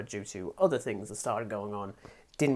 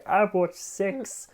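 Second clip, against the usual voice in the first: slow sheepish admission; 7 semitones higher, much slower than usual. Retold or imitated. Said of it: "me like".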